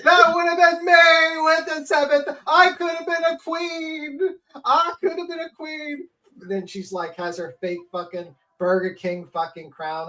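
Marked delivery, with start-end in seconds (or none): 0.0-6.1 high, slow, hammed-up sobbing; mock crying. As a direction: wail theatrically like a sore loser.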